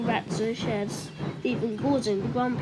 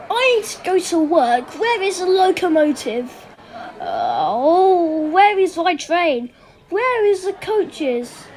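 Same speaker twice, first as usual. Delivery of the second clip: loud, higher, impatient complaining; grumpy passengers; 6 semitones higher, 7 dB louder, slower than usual. Acted. Commuters complaining loudly about the late train. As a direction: demand the missing train.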